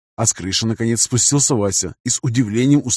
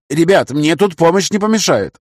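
raised call for help across the room; 6 semitones higher, 4 dB louder than usual. A narrator's reading. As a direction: call loudly to the others. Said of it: "позвал я".

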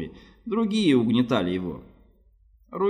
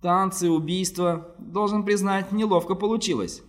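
higher, nervous little man; 8 semitones higher than usual. A narrator's reading. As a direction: fumble it out nervously. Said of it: "сказал нервно Боллард".

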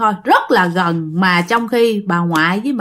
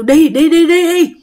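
higher, louder chasing-off cry; shooing shout; 6 semitones higher, 4 dB louder than usual. Retold or imitated. Grandma chasing the ghost out with branches, shooing loudly. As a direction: shoo the ghost away like grandma. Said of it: "ngoại kêu".

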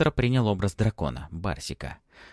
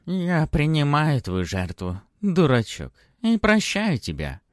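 put-on old crone voice; 6 semitones higher, 4 dB louder than usual.

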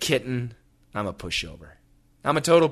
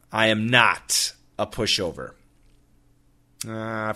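ordinary talk, nothing marked

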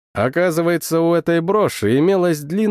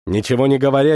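ordinary delivery

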